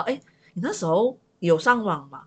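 normal talking voice

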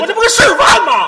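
shrieked self-justification, high and loud; worked-up protest. Acted. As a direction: protest as if losing all composure. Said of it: "急什么呀".

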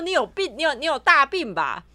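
loud, high, indignant retort; mock insult. Imitating the scammer yelling at her, loud. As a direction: fire the insult back loudly. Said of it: "他就骂我说".

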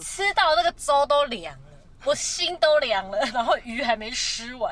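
loud, slowed lament; dramatic lament. Real, not acted. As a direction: ham up the sorrow. Dramatic lament about cold porridge.

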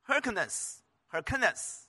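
Hyrcanus said slowly, stress on the second syllable. Shown as a demonstration. Pastor teaching the king's name, Hyrcanus, stress on its second syllable. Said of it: "发音重音在第二节音节".

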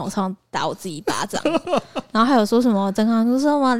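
ordinary talk, nothing marked

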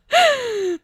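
short laugh from up high; a quick high cackle; high falling laugh. Real, not acted. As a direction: burst of laughter dropping down. Laughing at her situation.

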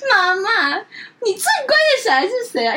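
high sing-song plea, lightly acted; a hint of babyish wheedling; coaxing daughter. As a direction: sweet-talk mom with a cute lilt. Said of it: "撒娇".

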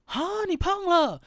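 high, loud, slow mock dismay; teasing coach. Other coaches pile on after the weigh-in, slow high teasing. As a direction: drag it out like a coach rubbing it in. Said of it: "其他教练听到就会讲说".

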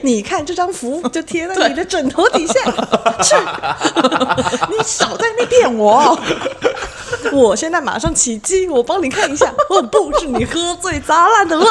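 high, laughing hammed-up exorcist; laughing mock exorcist. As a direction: play the exorcist while cracking up. Role-playing exorcist, laughing.

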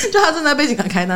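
gleeful laughing repeat. Real, not acted.

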